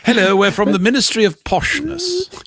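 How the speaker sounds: high-pitched mock-posh announcement. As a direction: put on an exaggerated posh accent. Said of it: "we could do posh".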